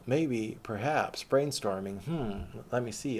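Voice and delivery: usual speaking voice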